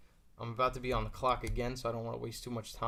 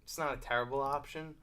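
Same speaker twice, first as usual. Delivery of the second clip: ordinary talk, nothing marked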